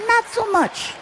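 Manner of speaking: high, softened comic verdict; squeaky aside. Real, not acted. Father admitting son's weak spot, high-voiced aside. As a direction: lift it into a small high voice.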